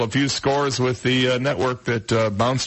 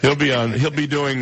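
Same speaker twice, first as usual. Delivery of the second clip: ordinary delivery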